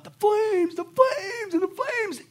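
very high, strained terrified cry; screaming in terror. Retold or imitated. Dying man in hospital, high terrified screaming. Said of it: "he's screaming about".

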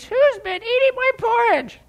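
high squeaky little bear voice; squeaky Baby Bear. A narrator's reading. Pastor telling kids the story, squeaky baby bear voice. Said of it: "And so he squeaks".

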